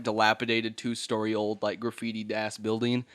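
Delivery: usual speaking voice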